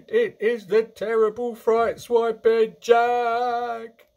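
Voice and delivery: slow, high, full-voiced howl of terror; bellowing. A narrator's reading. Giant tormented by Jack in his nightmare, bellowing. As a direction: bellow each phrase, dragging out the endings. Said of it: "bellowed the flesh lump eater".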